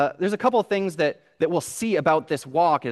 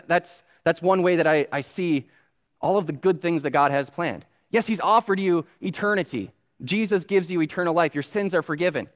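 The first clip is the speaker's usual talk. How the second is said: ordinary talk, nothing marked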